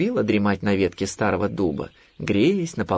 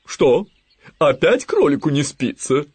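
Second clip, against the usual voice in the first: raised, loud fussy owl voice; worried owl; 6 semitones higher, 5 dB louder, slower than usual. A narrator's reading. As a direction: ask with alarmed concern as the owl. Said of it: "забеспокоилась сова". (обеспокоенный громкий голос совы)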